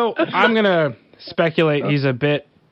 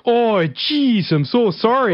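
high, apologetic Canadian caricature; overly polite hammy Canadian; Canadian accent; 9 semitones higher, much slower than usual. Shown as a demonstration. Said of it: "have, like, a caricature Canadian voice".

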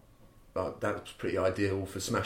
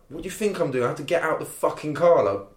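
higher, exasperated snapping back; indignant retort; 7 semitones higher than usual. Retold or imitated. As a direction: fire it back, irritated. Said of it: "and I'm like".

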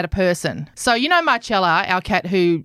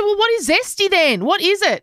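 high questioning voice; mock-innocent mum; 10 semitones higher than usual. Mother retelling her own question to the kids, high. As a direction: ask it all innocent and high. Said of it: "And I said".

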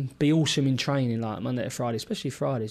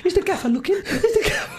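put-on very high Welsh accent, laughing; squeaky-high Welsh impression breaking into laughter; Michael Flynn; faster than usual. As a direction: mimic a Welsh player nervous of the boss. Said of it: "this is how he used to talk".

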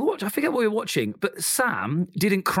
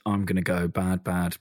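lower, deadpan echo of "bad, bad"; lower mock tough guy; 8 semitones lower than usual. Friend echoing the host's joke, lower mock tough-guy. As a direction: parody him in a gruff low tone.

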